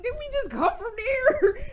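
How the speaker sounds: loud, very high-pitched disbelief; incredulous protest. Retold or imitated. Player answering characters who want to go back, squealed incredulity. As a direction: squeal it in mock-whiny disbelief. Retelling her protest at the game.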